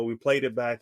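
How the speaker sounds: ordinary talk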